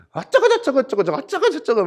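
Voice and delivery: high, loud mock bickering jabber; imitated squabbling kids. Father imitating his kids fighting in the back, noisy squabble. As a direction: babble like children fighting over each other. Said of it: "얼마나 피 터지게 싸우는지".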